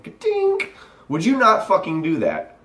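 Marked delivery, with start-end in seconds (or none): 0.0-0.7 very high-pitched "ka-tink"; squeaky little mechanical ding; imitated switch sound. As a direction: chirp a tiny high switch-off click.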